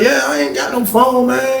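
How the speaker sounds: high, slow mock-innocent denial; dodging denial. Sticking to the story with Leroy, high drawn-out denial. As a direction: stretch it out high, playing innocent.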